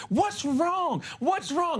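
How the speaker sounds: high, imitated teacher voice; teacher to kid. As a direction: coo it as if talking to a small child. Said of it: "he's talking to the kid".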